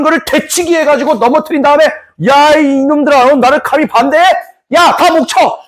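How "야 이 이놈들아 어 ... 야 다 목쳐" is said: higher, drawn-out indignant roar; hammed-up outraged ruler ordering punishment; mock royal fury. King to nobles who opposed him, mock outrage.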